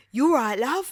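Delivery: hearty call of a biker bloke; rough biker greeting. Retold or imitated. Motorbike buyer greets the caller, mock gruff. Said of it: "they'd be like".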